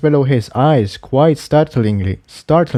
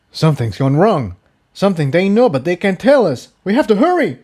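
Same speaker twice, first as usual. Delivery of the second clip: urgent call, pitch climbing to a high cry by the end; a possessed unicorn warning his friend, lightly voiced; mild mock shout; 8 semitones higher, faster than usual. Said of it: "Jigsaw shouted".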